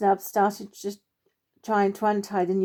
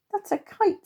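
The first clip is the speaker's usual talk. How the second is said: squeaky little squirrel, much higher; 12 semitones higher than usual. Acted. Young squirrel Timmy tells his friend, in a high little voice. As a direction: pitch it way up like a small squirrel.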